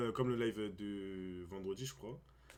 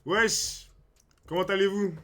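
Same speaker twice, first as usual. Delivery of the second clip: hype greeting; 8 semitones higher, 10 dB louder, slower than usual. Real, not acted. Streamer greeting viewers loudly.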